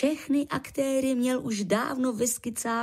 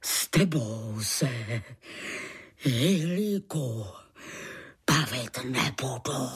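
deep, slow, drawn-out refusal; restrained roar; 8 semitones lower, slower than usual. A narrator's reading. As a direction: dismiss them in a low, heavy voice. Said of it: "zařval Tulamor senior". (pomalý, hluboký, zadržovaný řev)

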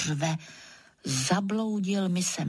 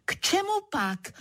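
high-pitched sharp question; storyteller's indignant mock yell; mock shout; 11 semitones higher than usual. A narrator's reading. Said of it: "Rozkřiknu se zas".

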